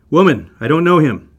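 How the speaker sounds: loud, sharp brush-off; flustered denial. Acted. Peter to the servant girl, denying him loudly. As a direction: shut her down fast.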